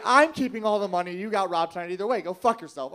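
loud, fed-up comeback; angry retort. Retold or imitated. To his friend after the robbery, angry retort. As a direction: fire back loudly at your friend.

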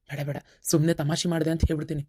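low, hushed, quick muttered aside to himself; hushed aside, ಸುಮ್ನೆ slipped in low; quiet aside. Acted. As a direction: plot the excuse under your breath, fast. Plans excuse in muttered aside.

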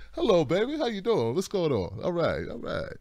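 nice-guy greeting. Acted. Obama shaking hands with everybody, soft nice-guy greeting. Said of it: "he just looked nice".

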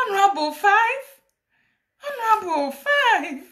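high-pitched, astonished questioning; mock-shocked protest; incredulous exclamation. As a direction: retell your disbelief, voice shooting up. Acted disbelief at a fifth round.

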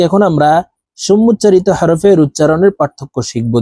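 normal talking voice

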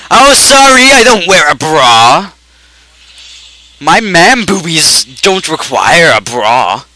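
exasperated, drawn-out sarcasm; sarcastic indignation. Real, not acted. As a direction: protest it slowly, stretching the words. Indignant at the bra answer.

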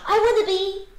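high, loud, breaking off; eager blurt. A narrator's reading. Alexander starts his wish to the lizard, eager. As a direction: blurt the wish and stop short.

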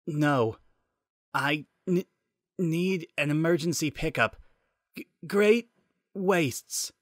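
stuttering broken radio voice; voice cutting out. Acted. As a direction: glitch and cut out on the words. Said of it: "His voice was cutting in and out".

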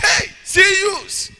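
high, slow call of the pastor; imitated daddy. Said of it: "He was saying".

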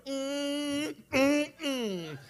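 high drawn-out hum, imitating the cook; knowing "mm, mm, mm". Cook seeing the weeping man, long high hum. Cook's reaction, acted.

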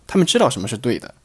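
ordinary talk, nothing marked

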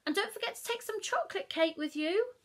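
cheerful reminder; Mum reminding. Acted. Mum voiced.

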